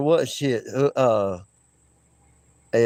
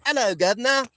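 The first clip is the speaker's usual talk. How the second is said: higher-pitched mock English greeting; English accent; 7 semitones higher than usual. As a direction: put on a jokey English accent. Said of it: "English accent, uh, impersonation".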